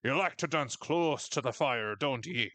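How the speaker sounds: put-on Nord accent, lilting; generic Nord. Podcast host quoting a game's stock line, Nord accent. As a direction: say it like a Nord villager from Morrowind. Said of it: "As the generic Nord voice in Morrowind would say".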